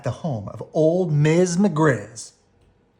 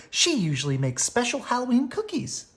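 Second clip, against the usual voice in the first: Sister voice; 11 semitones higher than usual. A narrator's reading.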